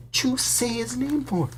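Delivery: high shocked conductor; shocked yelp. Acted. Stan scolding Harry for the name, high alarmed. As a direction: cry out in alarm. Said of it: "yelped Stan".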